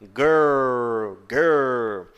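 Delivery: put-on "Girl, girl" with a strong curled, rural R and an L at the end; "Girl" with an exaggerated curled R. Shown as a demonstration. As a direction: exaggerate the R in "Girl" like a country accent. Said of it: "R um pouco mais caipira".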